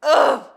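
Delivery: loud, high "ugh" of annoyance; real frustrated outburst; frustrated groan. Real, not acted. Pissed about unfinished series.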